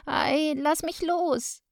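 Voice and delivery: high, drawn-out pained whine; wailing plea. A narrator's reading. Child struggling out of mother's painful grip, wailing. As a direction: plead to be released, wailing high. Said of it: "jammerte ich".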